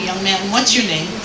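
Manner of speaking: ordinary talk, nothing marked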